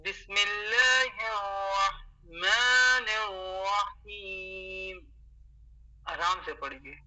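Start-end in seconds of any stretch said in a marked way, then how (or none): 0.0-5.0 بسم اللہ الرحمن الرحیم very slow, every letter clear; model recitation. Shown as a demonstration. Teacher showing students how to recite, very slow and clear. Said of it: "پڑھنا ہے ایسے".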